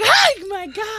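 high, loud mock panic; freaked-out scream. Trapped in a dark basement, high loud mock panic. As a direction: shriek like you're trapped. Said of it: "but I was like".

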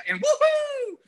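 high, loud mock cheer; excited woohoo. Imagined new fan cheering a song, high loud squeal.

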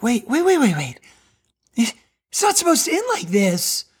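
high, stunned wait wait wait; a reader refusing to accept the ending; shocked disbelief. Retold or imitated. Reader stunned by the Gospel ending, high disbelief. Imagined reader reacting to the Passion.

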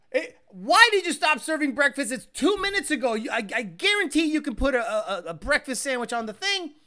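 hammed-up fury at the breakfast counter; mock angry outburst. Retelling the gunman demanding breakfast, mock angry.

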